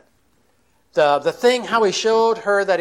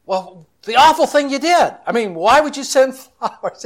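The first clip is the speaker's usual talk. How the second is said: high, loud, anxious demand; a wife frantic over a surprise bouquet; upset confrontation; 9 semitones higher, 5 dB louder than usual. Retold or imitated. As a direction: blurt out her dread at him. Wife confronting husband about flowers.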